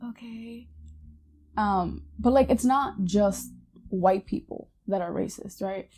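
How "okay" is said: barely-there okay after a breath; hushed awkward okay. Retold or imitated. Acting out co-worker's silent stare.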